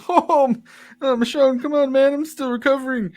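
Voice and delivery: groggy shopkeeper voice. Acted. Worn-out shop owner protesting to his friend, high and tired. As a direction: beg off, still recovering. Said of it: "and he goes".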